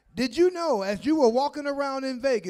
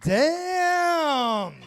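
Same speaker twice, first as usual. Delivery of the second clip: high laughing shout; laughing exclamation; 6 semitones higher, 5 dB louder than usual. Real, not acted. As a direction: burst out on arrival. Comic reacts to reunion.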